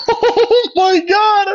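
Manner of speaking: very high, squealing laughing words; real laughter. Real, not acted. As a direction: crack up while talking, pitch soaring. Laughing at own forehead on camera.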